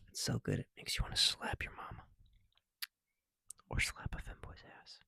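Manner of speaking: breathy ASMR whisper; joking ASMR whisper. Real, not acted. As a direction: whisper it close to the mic. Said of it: "I'm trying to A S M R this".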